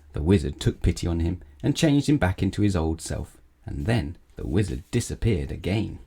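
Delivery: usual speaking voice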